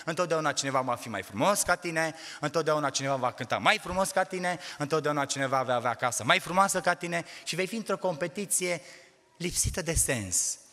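building sermon cadence; preaching. Acted. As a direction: drive the point home to the church. Preacher exhorts the congregation.

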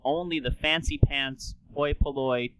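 ordinary talk

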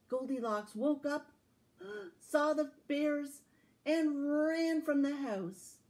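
gasp, then tell the escape with rising drama; excited climax. Acted. Climax of the story.

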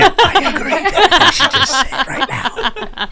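high, slow, giggly put-on voice; teasing quiet-guest impression. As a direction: poke fun at the quiet one. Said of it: "The silent whisperer comes in".

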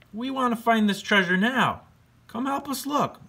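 very high, sing-song ghost voice; friendly ghost. Acted. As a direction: float it up high like a goofy ghost. Ghost urges the bat.

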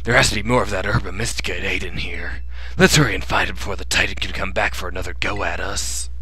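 whisper. Acted.

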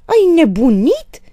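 high, loud, stretched scream; shriek. A narrator's reading. Mother aghast at her daughter's threat, shriek. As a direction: scream it in shocked disbelief. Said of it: "țipă Aristița".

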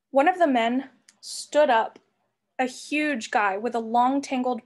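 ordinary delivery

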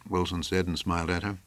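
plain reading voice

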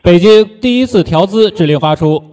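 loudspeaker announcement. Real, not acted. Loudspeaker relay of command.

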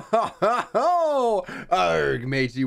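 laughing into a light mock pirate growl; a hint of a cartoon pirate. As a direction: ham up a pirate for a second. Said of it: "Pirates freaking greed".

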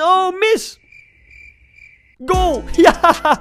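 loud, high shout of joy at the goal; excited shout. Real, not acted. Gamer yelling at his penalty shootout, excited. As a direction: let it burst out as the ball goes in.

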